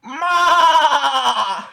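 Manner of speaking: loud, laughing moo; goat call. A narrator's reading. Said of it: "said Goat".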